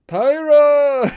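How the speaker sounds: high, loud drawn-out name call; imitated bad-dub shout. Podcaster mocking Rex's English dub, hollered shout. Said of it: "yell Pyra's name out loud, like, not just".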